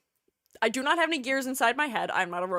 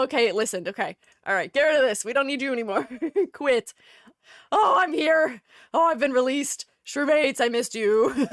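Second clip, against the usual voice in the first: ordinary talk, nothing marked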